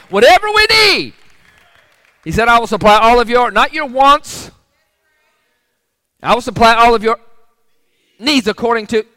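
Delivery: loud, high, shouted preaching; full force preaching. Acted. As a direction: preach it out to the back row.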